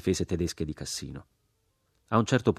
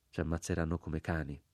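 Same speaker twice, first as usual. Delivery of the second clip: repeated inwardly; 8 dB quieter than usual. A narrator's reading. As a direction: say it softly, as an inner thought. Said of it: "ripetei dentro di me". (ripetuto piano, dentro di sé)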